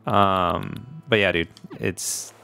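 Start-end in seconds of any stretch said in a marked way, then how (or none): none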